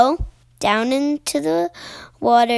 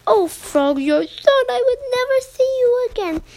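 relieved mom voice; 12 semitones higher than usual. Acted.

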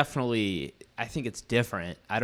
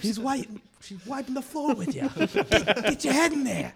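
much higher, strained cornerman; frantic corner yell; 14 semitones higher than usual. Retold or imitated. Cornerman yells at the beaten fighter, much higher. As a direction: scream advice at your boxer. Friend joins the corner yelling.

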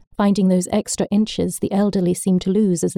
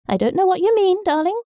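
put-on old grandma voice; 12 semitones higher than usual. A narrator's reading. Grandma evades, high voice.

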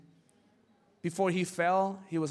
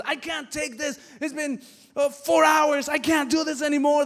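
higher and louder until it breaks into a shout; exasperated complaint; 8 semitones higher, 8 dB louder than usual. Retold or imitated. Wife begging the psychologist to let her quit, frantic shout.

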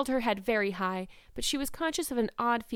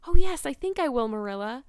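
optimistic; 4 semitones higher, 11 dB louder than usual. A narrator's reading. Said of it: "returned Anne optimistically".

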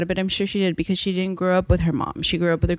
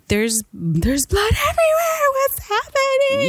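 slow, high frightened wail; scared kid crying out over the blood; panicked high cry; slower than usual. Retold or imitated. Panicking quote of her younger self.

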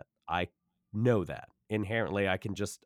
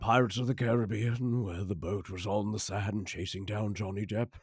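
grand narrator voice. Pretend. Guest describing the pirate ship boat in narrator voice. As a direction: deliver it like a film narrator. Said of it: "I didn't know you had this voice".

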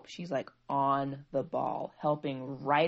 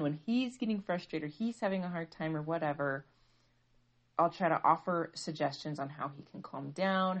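usual speaking voice

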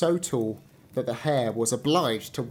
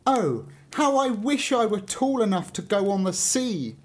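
higher, wistful tree voice; longing fir tree; 7 semitones higher than usual. A narrator's reading. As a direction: sigh it out like a yearning little tree. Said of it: "said the fir tree".

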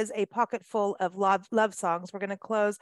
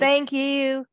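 "Thank you" drawn out long and slow in an Arkansas accent, a little louder; Arkansas drawl; 4 semitones higher, 5 dB louder than usual. Shown as a demonstration. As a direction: stretch "Thank you" lazily like a laid-back Arkansas local. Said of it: "it's a long and drawn".

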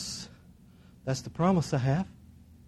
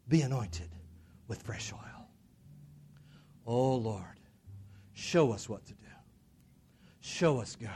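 hushed prayer; 6 dB quieter than usual. Real, not acted. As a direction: let the voice sink into quiet supplication.

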